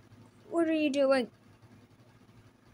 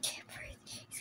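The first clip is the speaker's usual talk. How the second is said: breathless whisper while being strangled; barely voiced gasping whisper; choked whisper; 19 dB quieter than usual. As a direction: force the words out with no air.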